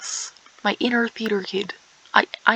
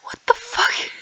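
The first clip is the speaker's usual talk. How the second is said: shocked whisper. Real, not acted.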